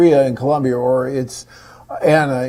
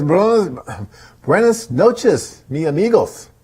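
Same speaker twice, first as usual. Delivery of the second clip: slow, higher, loud mock Spanish on buenas noches; hammed-up Spanish; 5 semitones higher, 5 dB louder, slower than usual. Reading a viewer's Spanish greeting aloud, hammed-up Spanish accent. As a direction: read the Spanish greeting like a bad tourist. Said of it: "You can tell that my Spanish is just perfect".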